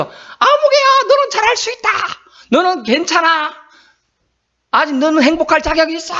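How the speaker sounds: high, stretched mock holler; calling out encouragement. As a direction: pretend to shout praise across the mountains. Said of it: "막 이렇게 외치면". (산을 향해 높게 외치는 격려)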